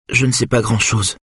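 ordinary delivery, nothing marked

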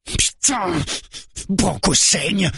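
high-pitched alarmed scream; panicked high cry, drawn out; sudden yell. A narrator's reading. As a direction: yell in alarm at the sight of blood. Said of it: "hurle soudain le blond".

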